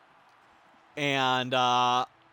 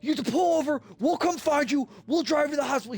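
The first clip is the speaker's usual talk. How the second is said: higher, fast, frantic imitation; panicking brother; 8 semitones higher, much faster than usual. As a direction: rush the words out in mock alarm. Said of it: "my brother screaming at me".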